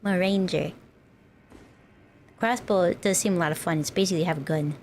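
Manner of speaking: ordinary talk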